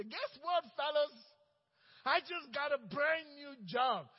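high, loud, thrilled announcement; a man telling his old coworkers about his new job, high and loud; excited news. Acted. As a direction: burst in with great news.

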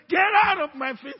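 loud forceful command; loud rebuke. Shown as a demonstration. Believer loudly rebuking Satan. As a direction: drive him away with your voice. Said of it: "he hears you".

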